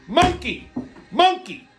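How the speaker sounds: high-pitched mimicked casino yell; imitated yelling. Imitating woman yelling at the baccarat table, high mock yell. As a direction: holler it in a high voice, imitating her. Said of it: "I hear her keep yelling".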